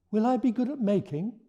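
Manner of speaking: high, hopeful question; hopeful small dragon. Acted. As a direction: ask shyly for reassurance. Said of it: "asked the speckled dragon".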